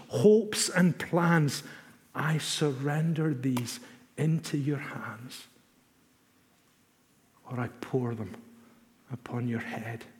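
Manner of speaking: slow, hushed prayer fading to near silence; prayerful recital. Acted. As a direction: offer it up slowly like a prayer. Recites a surrender prayer slowly.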